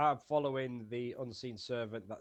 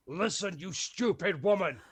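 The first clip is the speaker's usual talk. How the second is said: loud, higher-pitched angry order; intimidating anger; 6 semitones higher, 5 dB louder than usual. Acted. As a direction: berate her to get the point across. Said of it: "I know it's gonna sound horrible".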